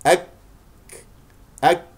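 أك with a firm K and delayed puff; stop, then breath. Shown as a demonstration. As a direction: close on ك in أك, pause, release air. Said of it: "هكذا". (أك بشدة ثم همس)

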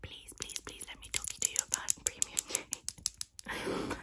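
pleading unvoiced whisper, drawn out slowly; back to whisper. Shown as a demonstration. ASMR host apologising to viewers, slow pleading whisper. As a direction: beg softly in a slow whisper. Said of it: "I got you".

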